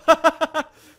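big high laugh bursting out; sudden loud laugh at a game bug; loud burst of laughter. Real, not acted. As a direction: crack up loudly at the glitch.

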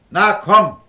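a firm, loud call to come out. Acted. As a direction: summon him out from under the bed. Calling boy from hiding.

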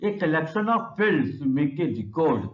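slowly repeated English line for students to note; teacher repeating a definition slowly; slow dictation. Shown as a demonstration. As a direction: dictate it again, word by word. Repeats the key exam definition.

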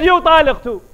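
loud, high shouted verdict; angry outburst. Retold or imitated. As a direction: fling it at her. Husband divorces neighbor's wife.

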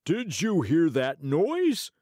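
high, slow startled weasel; a jumpy weasel, high and loud; sly hissing weasel. Acted. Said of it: "asked the weasel".

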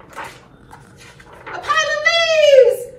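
high, loud, slow reveal; dramatic announcer. Acted. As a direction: announce the challenger with big drama. Announces dinosaur's opponent to children.

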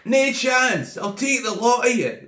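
put-on brash drunk calling out a whole army; cocky shout. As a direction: shout the dare back at the king. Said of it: "the wee Scottish guy's going".

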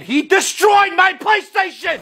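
high whiny shout. Acted. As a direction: yell the complaint. Kid shouts complaint.

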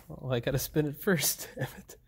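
ordinary talk

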